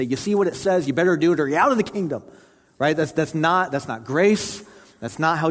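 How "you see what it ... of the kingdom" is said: higher, fast mock command; mock snappy ultimatum. Preacher mimicking a finger-snapping demand, mock stern and hurried. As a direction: imitate an impatient order in a quick, raised voice. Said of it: "me snapping my fingers and say".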